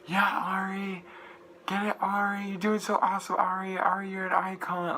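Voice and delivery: high-pitched fangirl cheering, imitated; screamed compliments. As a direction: cheer the star on in a high, held-back scream. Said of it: "scream compliments and be like".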